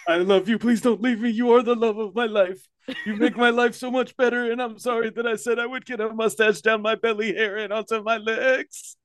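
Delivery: high, pleading whine; begging an ex back. Pretend. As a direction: beg like a desperate husband. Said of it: "Man up".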